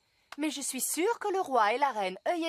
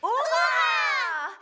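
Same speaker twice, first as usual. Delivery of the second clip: cri de joie; 9 semitones higher, 6 dB louder than usual. Acted. Joie à la bonne nouvelle.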